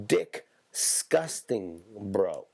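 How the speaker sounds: Disgusting drawn out very slowly; stretched-out disgusting. Shown as a demonstration. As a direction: stretch Disgusting long and slow. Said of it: "than just regular disgusting".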